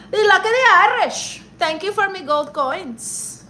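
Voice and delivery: high, loud hammed-up Irish leprechaun voice; mock Irish accent. Streamer thanking a viewer for gold coins, in a hammed-up Irish accent. Gift alert read in Irish voice.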